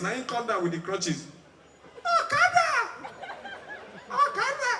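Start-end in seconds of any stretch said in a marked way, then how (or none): 2.0-4.7 very high-pitched call. Acted. Ambulance man hails an okada, high calling out. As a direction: hail a bike taxi in a high cry. Acted call to a motorbike taxi.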